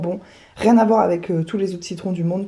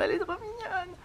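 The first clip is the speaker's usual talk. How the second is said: cooing; 10 semitones higher, 13 dB quieter than usual. Real, not acted. Cooing at pet.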